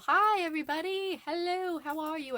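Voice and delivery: high, bright hello to arriving viewers; excited greeting. Real, not acted. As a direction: greet the crowd with a lift. Greeting viewers as they join.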